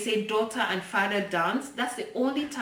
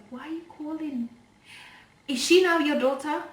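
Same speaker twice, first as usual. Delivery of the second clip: a hint of a sharp, higher indignant mother; 6 semitones higher, 3 dB louder than usual. Mother confronting ex about his sudden interest, indignant. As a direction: challenge him, a touch higher. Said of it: "And my mom was like".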